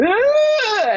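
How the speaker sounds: high startled "Ah!"; a sudden high gasp of realisation; surprised gasp. Retold or imitated. Client's exclamation in retold session.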